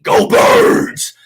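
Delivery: rally the whole fanbase; fan rallying cry. Real, not acted.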